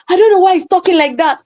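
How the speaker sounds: a hint of a Jamaican voice, quick and high; imitating the Jamaican doorman. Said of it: "And the guy was Jamaican".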